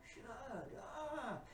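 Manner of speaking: mock shut up. Woman's exclamation, retold.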